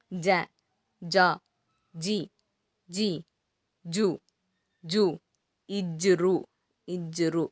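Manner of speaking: j-syllable series chanted evenly, a pause after each; pronunciation demo. Shown as a demonstration. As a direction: recite ஜ through ஜக one syllable at a time. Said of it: "நான் ஒரு சில line மட்டும் சொல்றேன்".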